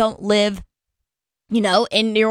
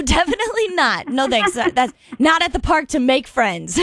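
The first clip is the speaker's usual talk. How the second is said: ordinary talk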